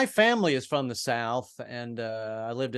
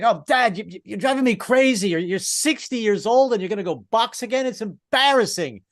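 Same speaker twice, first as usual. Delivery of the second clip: higher-pitched, exasperated whine at Dad; pissed-off son; 7 semitones higher than usual. Acted. Rocky's son berating his boxing dad, mock pissed-off. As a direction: scold your embarrassing father, acted. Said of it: "Rocky's son was pissed at him".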